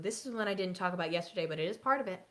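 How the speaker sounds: ordinary delivery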